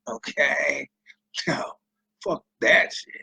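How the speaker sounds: ordinary talk, nothing marked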